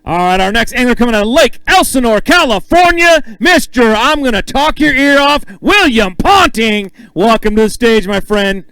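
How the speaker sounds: loud, stretched-out announcer intro; big stage emcee calling the next angler up. Acted. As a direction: boom the name out like a ring announcer.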